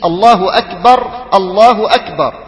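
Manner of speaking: أكبر ending on a silent ra, akbar; الله أكبر with final ra still. Shown as a demonstration. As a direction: stop on the ra of أكبر with sukun. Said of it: "بإسكان الراء".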